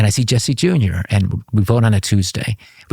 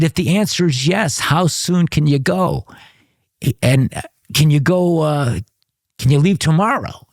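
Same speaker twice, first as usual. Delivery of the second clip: urgent coded question; 6 semitones higher than usual. Retold or imitated. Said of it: "Talk to me in code".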